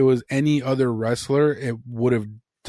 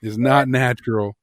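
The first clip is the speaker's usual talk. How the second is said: mock outrage; 6 dB louder than usual. A fan recalls pausing the video and ranting, loud mock outrage. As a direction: rant like a grumpy old fan betrayed. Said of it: "and I was like".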